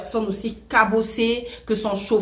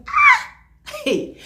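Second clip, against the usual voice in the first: a sudden high yelp of protest; Ah and Hey shouted high with a laugh; startled shout; 5 dB louder than usual. Retold or imitated. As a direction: cry out in alarm. Speaker bursts out at friend.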